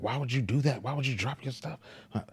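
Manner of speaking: mock scolding son. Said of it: "this is you yelling at my child".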